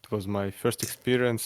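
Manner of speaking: ordinary talk, nothing marked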